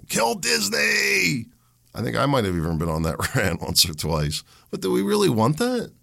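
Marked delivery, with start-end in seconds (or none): 0.1-1.5 high, slow drawn-out cheer of a rowdy fan; a hammed-up sports fan roaring for blood; mock crowd shout. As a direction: yell it like a fan in the stands. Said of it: "We're all like".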